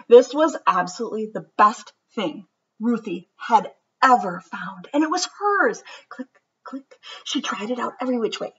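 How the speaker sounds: lively, sing-song storybook read-aloud; reading to children. A narrator's reading. Teacher reads a picture book to her class, lively and sing-song. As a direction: read it like story time for kids.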